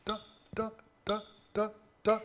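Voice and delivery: very soft detached "ta" like plucked strings; whisper-light "ta" string pizzicato; soft staccato. Shown as a demonstration. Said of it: "like a string playing like this".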